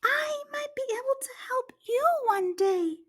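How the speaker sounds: squeaky, small and hopeful; high tiny mouse voice. Acted. Mouse offering help to the laughing lion, high and squeaky.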